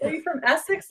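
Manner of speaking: high, rising hopeful question; excited question. Retold or imitated. Asking the lady if she's from Essex, high and eager.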